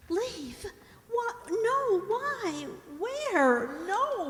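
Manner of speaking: high, panicked pleading; frantic, refusing to go; frightened protest. Acted. Helen protesting being sent away, high and panicked. Helen told to leave the house.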